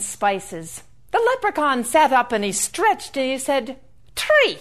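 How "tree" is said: calling out brightly. Acted. Leprechaun calls up to tree.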